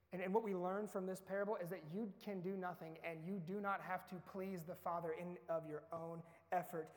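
ordinary talk

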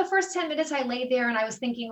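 usual speaking voice

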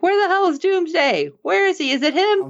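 high, urgent questions; firing off panicked questions about a missing villain; frantic asking. Retold or imitated. Fan acting out panic at the screen, high and urgent. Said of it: "I'm like".

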